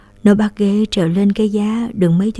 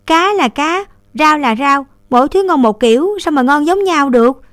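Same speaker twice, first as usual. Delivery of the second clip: higher, sniffy big-sister put-down; scornful; 7 semitones higher, 3 dB louder than usual. A narrator's reading. Older sister scolding her little brother's comparison, scornful. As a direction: scoff at the little one's silly question. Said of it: "Đường nguýt dài em".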